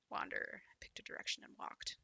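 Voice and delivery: whispered game narration; soft story reading. Acted. Reading the game text aloud in a whisper. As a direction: read it hushed and eerie.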